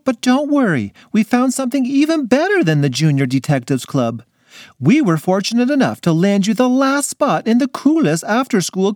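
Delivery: high, cheery mom voice; delighted mother. Acted. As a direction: sell it like a treat. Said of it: "said her delighted mother".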